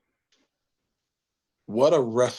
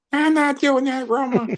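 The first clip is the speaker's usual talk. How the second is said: much higher, louder indignant refusal; high mock protest; 5 dB louder than usual. Refusing Roma's offer in a shrill mock falsetto. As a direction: squeal it out, scandalized. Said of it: "Roma would say".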